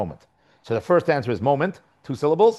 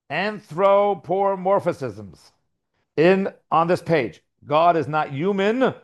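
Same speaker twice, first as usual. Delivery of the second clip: higher, measured formal read-out; reading aloud; 5 semitones higher than usual. Acted. Teacher reading a commentary note to his class, formal read-out. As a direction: read it out deliberately, word by word.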